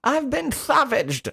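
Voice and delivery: very high, slow lisped imitation; hammed-up "savaged" with a lisped s, high and drawn out; Vera. Host repeating the film catchphrase as a joke, high lisp. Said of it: "like, this weird lisp".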